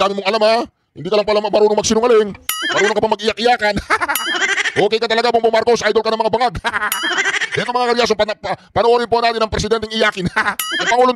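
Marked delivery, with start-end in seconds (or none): 3.8-4.6 mocking laugh. Real, not acted. 6.6-7.5 mocking laugh. Real, not acted.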